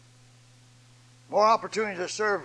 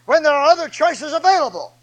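high, loud pulpit proclamation; full-force preaching; 10 semitones higher, 14 dB louder than usual. Acted. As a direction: drive each phrase home to the congregation, high and loud.

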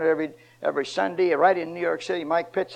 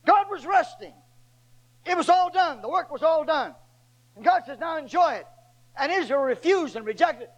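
full-force preaching; 8 semitones higher than usual. Acted. Preacher to his congregation, at full force.

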